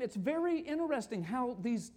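usual speaking voice

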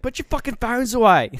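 loud, high mock-Aussie shout; poor Australian accent. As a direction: bellow an order in bad Australian. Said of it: "really poor Australian accent".

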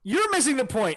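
heated retort. Real, not acted. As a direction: throw it back, heated. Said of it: "you're a little heated up".

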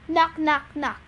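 ordinary delivery

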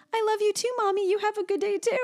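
gushy imitation, lifted high; mimicking the counselor. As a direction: parrot the counselor's cutesy voice. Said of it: "and she goes".

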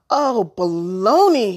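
loud, drawn-out exclamation; slow, loud cry of dismay; calling out. A narrator's reading. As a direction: call out in exasperation, stretching the words. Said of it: "he called out".

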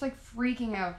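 usual speaking voice

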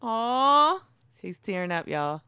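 ordinary delivery

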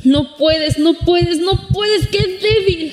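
mock yourself like a cruel inner critic; taunting inner voice. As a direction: inner critic jeering at her weakness. Mocking inner voice answers.

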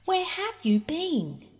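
high, slow call from the top of a wall; shouted. A narrator's reading. Peter on the wall calls to Bobby, high shout. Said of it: "shouted Peter".

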